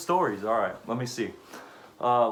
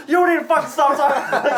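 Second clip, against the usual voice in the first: high shouted order through laughter; mock screaming; 12 semitones higher, 8 dB louder than usual. Screaming heard from car.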